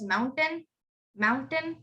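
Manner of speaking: "mountain" with a full T, Indian accent, rising. Shown as a demonstration. Teacher contrasts for students, Indian accent on mountain. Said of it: "how you would say it in an Indian accent".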